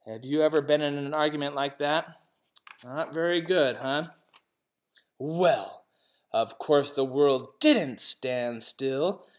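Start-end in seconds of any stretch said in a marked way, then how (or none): none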